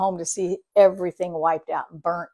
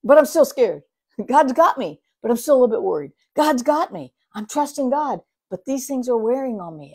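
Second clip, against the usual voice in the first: worried David; 6 semitones higher, 7 dB louder than usual. Retold or imitated. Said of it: "David's even asking himself".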